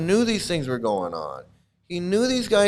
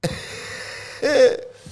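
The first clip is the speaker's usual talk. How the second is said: silent breathy laugh; wheezing laugh; 10 semitones higher than usual. Real, not acted. Reader cracking up at the transcript, silent breathy laugh. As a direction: laugh without voice.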